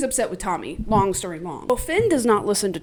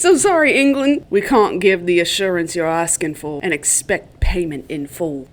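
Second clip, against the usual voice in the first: put-on rough go at Arthur's British accent; attempted British accent; 3 semitones lower, 5 dB louder than usual. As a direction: read the letter aloud in a put-on British accent. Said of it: "try and do his accent".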